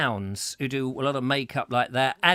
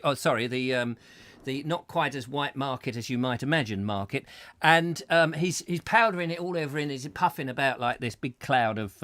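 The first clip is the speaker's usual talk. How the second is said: ordinary delivery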